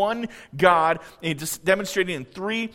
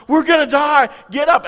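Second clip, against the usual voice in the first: loud, high, panicked cry for help; panicked shout; 7 semitones higher, 8 dB louder than usual. Acted. Disciples shouting to wake Jesus in the storm, panicked. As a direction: shout to wake him, terrified.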